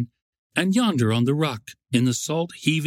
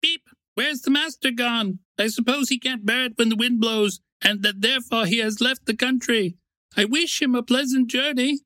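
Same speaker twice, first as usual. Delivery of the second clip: much higher, chirpy little bird voice; cheeky sparrow; 11 semitones higher than usual. Acted. Sparrow in the stork's empty nest, cheekily gossiping. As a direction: pipe it up high like a small sparrow.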